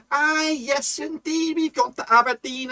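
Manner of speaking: put-on a high, loud Scottish accent that slips toward Glaswegian; cheery Scottish accent. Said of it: "I'll even do the accent".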